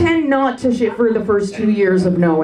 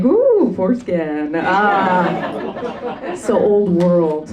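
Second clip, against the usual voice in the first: mock delighted ooh; 3 semitones lower, much slower than usual. Reacting to a photo.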